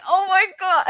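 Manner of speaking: high, disbelieving oh my God, caught off guard; exasperated gasp. Retold or imitated. Mother in the store reacting to her toddler clinging under a cart, high disbelieving. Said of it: "And I was just like".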